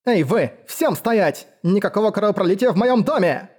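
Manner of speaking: high, urgent order to everyone; commanding shout. Acted. Woman forbids bloodshed in her house, commanding cry. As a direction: raise the pitch and take command. Host orders brawlers to stop.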